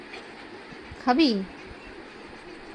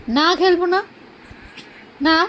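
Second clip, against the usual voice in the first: firm refusal; 6 semitones higher, 3 dB louder, slower than usual. Real, not acted. Refusing to play with dog.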